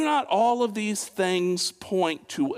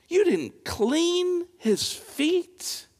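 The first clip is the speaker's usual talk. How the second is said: high, slow disbelieving question; drawn-out stunned question; incredulous; 13 semitones higher, slower than usual. Pretend. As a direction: stretch it out in disbelief. Incredulous questioning of Simon's neglect.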